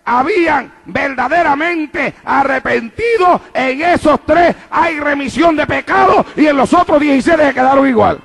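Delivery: impassioned preacher cadence; full-voiced sermon delivery; preaching. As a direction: preach it with rising fervor.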